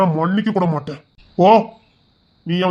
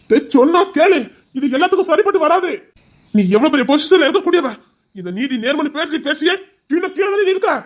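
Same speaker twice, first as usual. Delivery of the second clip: urgent pleading; 9 semitones higher than usual. Acted. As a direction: plead hard to make him listen. Pleading with stubborn brother.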